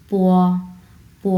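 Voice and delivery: প drawn out as a gliding "pwo"; actual Chinese pronunciation. Shown as a demonstration. Teacher showing the actual Chinese sound, drawn-out glide. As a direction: glide from প into a long o. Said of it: "এটার actual উচ্চারণ".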